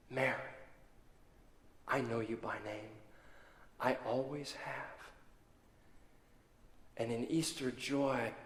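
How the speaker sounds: tender. Acted. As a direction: speak gently as Jesus to Mary. Jesus speaks gently to Mary.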